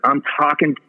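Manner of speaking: ordinary talk, nothing marked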